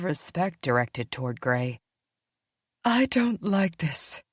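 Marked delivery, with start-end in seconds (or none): 2.8-4.2 higher, slow and distrustful; a female wolf objecting to the stranger; wary she-wolf. Acted. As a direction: voice your doubt to the circle. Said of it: "one of the females spoke first".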